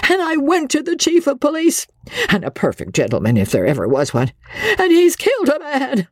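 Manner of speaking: high, trembling insistence; high, quavering. A narrator's reading. Frightened wife pleading high and quavering with her husband. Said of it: "Her voice was high and quavering".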